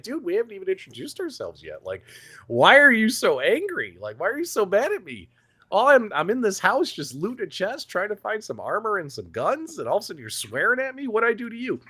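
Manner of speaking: high-pitched, pleading indignation; mock bewildered protest. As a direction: act out a baffled, wronged player. Acts out protest to angry player.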